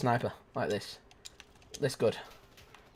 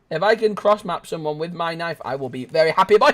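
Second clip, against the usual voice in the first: normal talking voice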